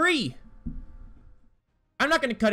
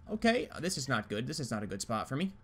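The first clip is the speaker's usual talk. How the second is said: usual speaking voice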